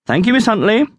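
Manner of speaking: high called-out thanks; lifted, sing-out cry of thanks. A narrator's reading. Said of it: "cried the cleaner".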